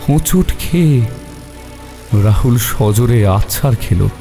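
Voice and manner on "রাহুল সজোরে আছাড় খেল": plain reading voice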